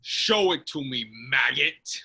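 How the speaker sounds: hulking fish-man demanding; monster thug. Acted. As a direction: sneer it down at him.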